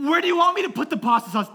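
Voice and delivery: fast, high, loud question; mock exasperated yell. Dad asking daughter about sauce, mock exasperated yell. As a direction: fire it off as a frustrated parent.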